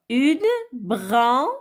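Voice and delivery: une, then brun as its own beat; syllable by syllable. Shown as a demonstration. Teacher cutting words up for children, une - brun spaced out. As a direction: separate une from brun, holding each. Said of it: "découper les mots en syllabes".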